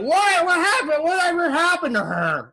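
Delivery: put-on high, curious drunk-guy voice; pry with high, eager interest; nosy drunk. Drunk guy at a bar butting in, high and curious. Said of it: "he's like".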